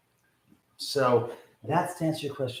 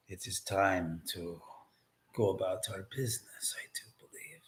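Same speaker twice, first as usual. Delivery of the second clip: hushed conspiratorial whisper; 6 semitones lower, 12 dB quieter than usual. Pretend. Mock vampire whispering to the audience. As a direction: drop into a spooky whisper. Dracula bit continues, whispered.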